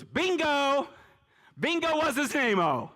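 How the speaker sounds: high, delighted shout of bingo; a hammed-up Jesus cheering his disciple; excited exclamation. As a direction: cheer like he hit the answer.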